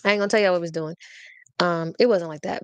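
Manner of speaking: ordinary delivery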